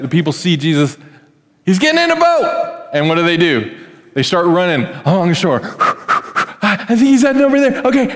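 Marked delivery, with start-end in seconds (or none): 1.6-2.9 high-pitched mock alarm at a sighting; excited crowd cry. People spotting Jesus leaving by boat, excited high cry. 5.7-6.6 quick puffing gasps; acted running pant. Crowd running along the shore, acted panting. 6.6-8.1 high, hurried mock excitement; excited running crowd. Crowd chasing Jesus along the shore, high excited rush.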